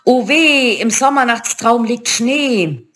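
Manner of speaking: high, drawn-out witchy lament; keening crone lament, pitched high; wailing witches. A narrator's reading. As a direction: wail woefully like old witches. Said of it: "klagten die anderen beiden Hexen".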